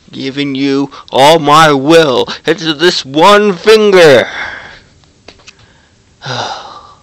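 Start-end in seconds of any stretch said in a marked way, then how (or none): 0.1-4.3 dramatic defiance. Pretend. Flipping off the TV dramatically.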